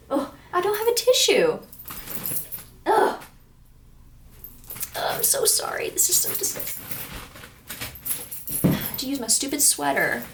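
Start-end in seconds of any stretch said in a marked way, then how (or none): 0.1-3.2 high, loud flustered oh no; panicking over a runny nose with no tissue; dismayed squeal. Real, not acted. Flustered vlogger squealing she has no tissue. 5.0-6.8 breathy embarrassed whisper to camera; whispered apology. Real, not acted. Embarrassed vlogger whispering sorry to viewers. Apologizing while wiping nose.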